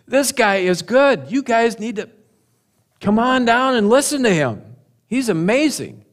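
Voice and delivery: awed enthusiasm. Acted. Guards report back to their leaders, awed and enthusiastic. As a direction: play an eager fan urging others along.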